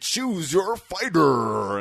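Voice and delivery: slow, booming announcer call; fighting game announcer. Retold or imitated. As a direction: drag it out like an arcade announcer. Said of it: "corny ass Mortal Kombat, like, lineup bullshit".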